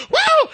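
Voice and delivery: high-pitched celebratory whoop; re-enacted jubilant "woo"; retold joyful whoop. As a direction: let out a new father's squeal of joy. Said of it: "I was shouting".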